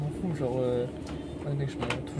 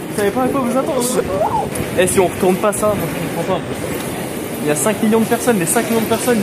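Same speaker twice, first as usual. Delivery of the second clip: hyped boasting; 6 semitones higher, 10 dB louder than usual. Real, not acted.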